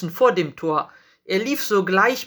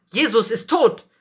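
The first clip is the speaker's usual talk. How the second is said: raised, higher voice of outraged priests; indignant; 5 semitones higher, 4 dB louder than usual. A narrator's reading. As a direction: protest with outrage, voice up. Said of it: "riefen sie empört". (empört und mit erhobener Stimme)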